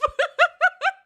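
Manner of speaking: a high, pained laugh that breaks toward a cry. Real, not acted. Streamer reacts to chat going off, high pained laugh. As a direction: laugh in disbelief, almost crying.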